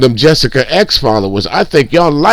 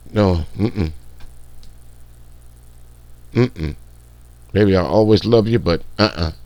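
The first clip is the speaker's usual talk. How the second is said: pained disbelief; 4 semitones lower, 9 dB quieter than usual. Pretend. Imagines seeing own daughter.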